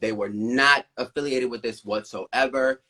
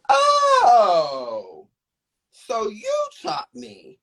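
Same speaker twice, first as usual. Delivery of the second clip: sarcastic realization; 11 semitones higher, 5 dB louder, much slower than usual. Retold or imitated. Sarcastic retort to the judge.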